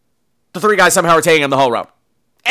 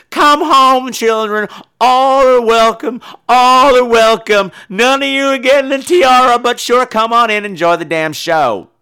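higher, louder, slow revival call; mock preacher welcome; 7 semitones higher, 5 dB louder, slower than usual. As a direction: summon the lost children home like a preacher. Preacher-like call to scream queens.

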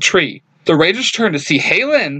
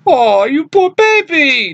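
put-on much higher, slow, sneering baby talk; snickering mock pity; 12 semitones higher, 4 dB louder, slower than usual. Villain mocks the exhausted Blue Ranger, slow high sneering coo. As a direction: taunt him like a bully cooing at a child. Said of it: "with a snicker".

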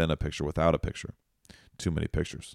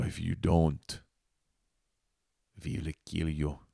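put-on Russian accent; 3 dB quieter, slower than usual. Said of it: "I made him sound Russian there".

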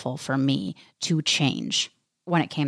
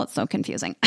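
whispered aside; 7 semitones lower, 4 dB quieter, faster than usual. Real, not acted. Joke about being single.

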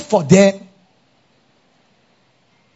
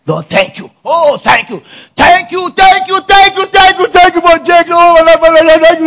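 much higher and louder with each thank you; mock ecstatic thanks; 10 semitones higher, 7 dB louder than usual. Congregation thanking God for a partner, climbing ecstatic thanks. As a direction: build into ecstatic praise. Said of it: "you all start thanking God".

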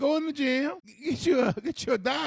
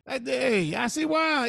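ordinary talk

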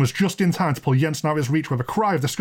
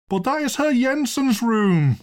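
high, drawn-out wail; 11 semitones higher, slower than usual. Acted. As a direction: wail slowly in protest, voice held high. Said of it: "wailed the landlord".

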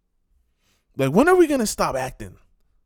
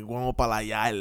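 Puerto Rican Spanish, low and thrown away; low Puerto Rican accent impression; imitated Puerto Rican accent; 9 semitones lower, 8 dB quieter, faster than usual. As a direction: lightly imitate Puerto Rican street talk, lower and softer. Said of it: "where else do you hear people talking like".

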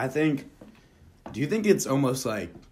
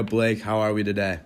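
ordinary talk

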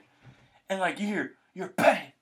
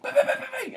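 high popping bangs; imitated firework bangs; 3 dB louder, faster than usual. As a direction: pop out the firework sounds. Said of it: "It's like".

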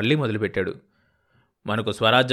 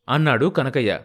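usual speaking voice

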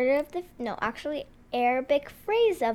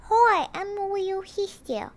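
higher-pitched little sister voice; 7 semitones higher than usual. Pretend. Little sister greets the podcast host, higher put-on voice. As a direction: pipe up high as the visiting little sister. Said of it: "I think my little sister came".

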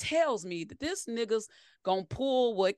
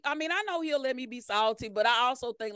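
ordinary talk, nothing marked

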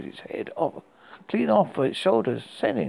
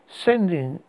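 plain reading voice

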